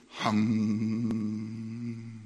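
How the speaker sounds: a flat, empty hum of nothing; zero's hum. Pretend. A zero's hum to show the company did nothing. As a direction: hum like a zero given a voice. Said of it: "That's the sound of a zero if it could have a voice".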